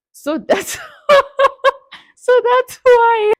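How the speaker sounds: high-pitched, helpless laughing through the words; breaking into laughter. Real, not acted. Cracking up at own accent attempt, laughing high. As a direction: crack up while trying to finish the sentence.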